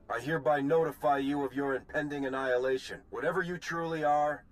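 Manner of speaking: formal proclamation. Acted. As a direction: announce his doom like a public broadcast.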